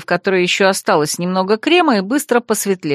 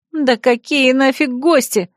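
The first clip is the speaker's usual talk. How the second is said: higher, drawn-out indignation; indignant raised-pitch retort; 5 semitones higher, slower than usual. A narrator's reading. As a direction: protest it in disbelief. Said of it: "возмутилась я".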